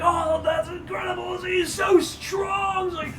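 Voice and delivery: adoring onlookers cheering; gushing crowd. Acted. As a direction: coo over the brave little man. Crowd praising the kobold.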